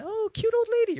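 very high, melting coo; cooing at cuteness. Retold or imitated. Chasing cyclist melts at sweet old lady, high coo. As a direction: gush like seeing a puppy. Disarmed by the tiny old woman.

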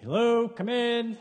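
high, slow, loud sing-song welcome; playful call to a door at night; mock greeting. Answers the tree at night.

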